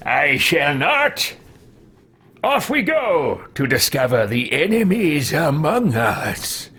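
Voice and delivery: slow, lightly voiced old adventurer; a hint of a slow old wizard, responding to a fan; old man Elderast. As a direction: answer chat as the old hero, slow and grand. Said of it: "when I'm playing an old man".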